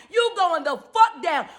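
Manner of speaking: ordinary talk, nothing marked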